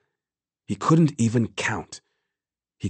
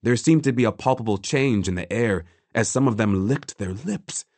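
ordinary delivery, nothing marked

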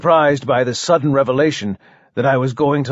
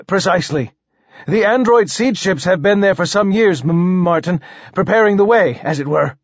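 higher-pitched monarch, slight stammer; stuttering king; 5 semitones higher than usual. Acted. King explains colony preparations to poet, lightly stammering. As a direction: explain precisely, catching briefly on a name.

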